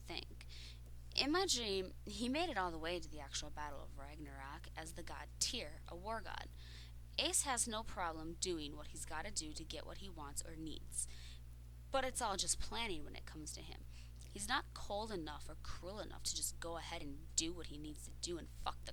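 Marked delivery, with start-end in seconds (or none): none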